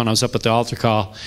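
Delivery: ordinary talk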